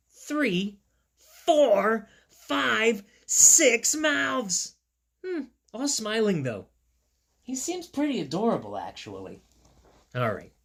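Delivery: plain reading voice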